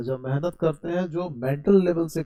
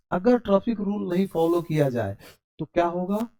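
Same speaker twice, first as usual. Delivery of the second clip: ordinary talk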